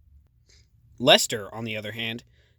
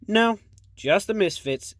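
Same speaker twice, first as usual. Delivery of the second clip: bright quip; 7 semitones higher, 4 dB louder than usual. Acted. Bobby quips back loudly.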